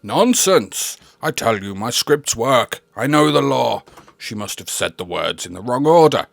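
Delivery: loud, blustering denial; indignant bluster. Acted. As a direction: bluster like a cornered conman. Scrooge defends his scripts.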